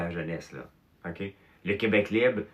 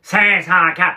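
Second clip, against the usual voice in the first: booming, much higher and louder; big voice; 10 semitones higher, 14 dB louder, much slower than usual. Retold or imitated. Claude Cloutier booming Cinq cent quatre to Pierre. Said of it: "il parlait avec une grosse voix".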